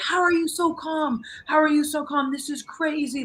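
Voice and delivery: high-pitched mimicked fan yell; mock screaming. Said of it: "screaming, coming up to me".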